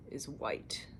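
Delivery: whispered aside; softly whispered side remark. Real, not acted. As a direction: drop to a hushed voiceless aside. Whispered aside about Ngozi.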